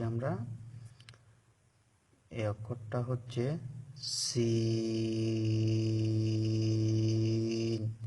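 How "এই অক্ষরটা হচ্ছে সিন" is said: সিন held long with a steady hiss; drawn-out sin. Shown as a demonstration. Teacher showing students the letter সিন, drawn out. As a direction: stretch the সিন out slowly. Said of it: "এই অক্ষরটাকে আমি টেনে উচ্চারণ করেছি".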